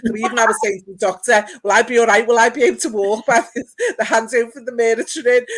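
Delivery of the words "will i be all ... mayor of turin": louder, half-laughing worried question; laughing nervous plea. Retold or imitated. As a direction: laugh through the retold panic. Retelling nervous plea to doctor.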